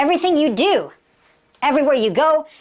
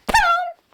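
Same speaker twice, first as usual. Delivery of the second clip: very high, sudden boom; high whooshing getaway noise; explosive boom. Shown as a demonstration. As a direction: imitate a cat shooting off instantly. Imitates cat bolting away.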